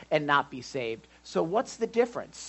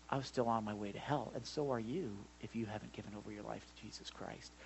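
ordinary talk, nothing marked

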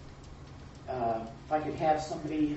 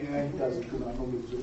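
inner voice; 4 semitones lower, 3 dB quieter than usual. Retold or imitated. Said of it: "Your little melon will say".

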